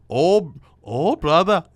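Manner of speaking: hammed-up Irish bar voice; mock Irish accent. Irish accent in an imagined bar, to his friend. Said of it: "how do you do an Irish accent?".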